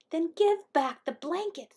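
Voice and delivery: high little bird voice; tiny Hummingbird. A narrator's reading. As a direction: demand it in a small, high voice. Hummingbird bargains with Coyote.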